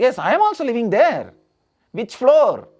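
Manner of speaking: high, fast, astonished bar patron; excited drinker in a joke, rushing and high; mock amazement.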